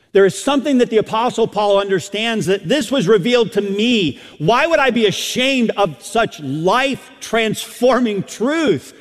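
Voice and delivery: loud, high preacher's voice; impassioned preaching. Real, not acted. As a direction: preach it out at full force. Preaches at full force.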